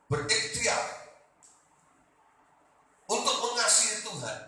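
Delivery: high, loud sermon delivery with long pauses; forceful preaching. Real, not acted. Preacher warning congregation about bitter hearts, forceful preaching.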